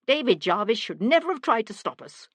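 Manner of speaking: high, loud, agitated protest; frantic self-justification; desperate blame-shifting. A narrator's reading. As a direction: insist on it, voice climbing. Guilty man shifts blame.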